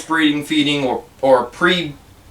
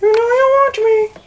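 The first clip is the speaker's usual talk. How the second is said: very high, slow sing-song coax to a pet; slower than usual. Real, not acted. Owner coaxing his snake Kratos to eat, in high baby talk. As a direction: coo up high to lure the snake in.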